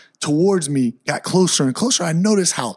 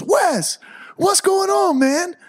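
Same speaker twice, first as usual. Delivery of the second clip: friendly greeting; slower than usual. Retold or imitated. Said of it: "he turns around and he goes".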